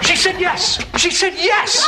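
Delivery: ecstatic. Acted. Telling friends she agreed to a date, thrilled. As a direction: burst with disbelieving joy. Ecstatic news after asking out.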